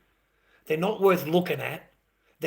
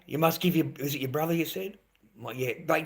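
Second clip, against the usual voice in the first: ordinary talk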